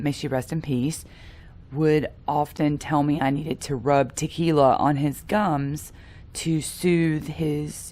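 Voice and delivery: ordinary delivery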